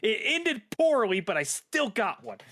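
high, slow mock-rueful admission; comic rueful aside. Real, not acted. Admitting the romance flopped, mock-rueful. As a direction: confess it with comic regret.

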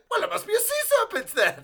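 mock stubborn believer. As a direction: insist like a silly villager. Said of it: "It's like".

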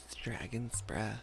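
soft, slow dramatic hush. Acted. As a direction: say it low like a secret. Dramatic aside, very quiet.

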